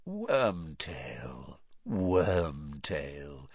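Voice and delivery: low, silky, cold croon; lightly voiced silky dark lord. A narrator's reading. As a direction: soothe him with menace. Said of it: "said the cold voice silkily".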